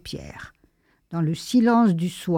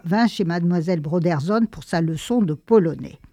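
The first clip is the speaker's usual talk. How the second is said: ordinary delivery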